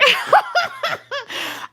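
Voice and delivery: high cackling laughter; real laugh. Real, not acted. Laughing at her own victim act, high cackle. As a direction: burst out laughing helplessly.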